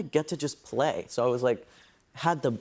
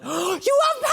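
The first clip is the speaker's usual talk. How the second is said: high, loud gasping shout; stunned shout at the script; excited shout; 5 dB louder than usual. Retold or imitated. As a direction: blurt it out in shock. Reenacting reading the reveal.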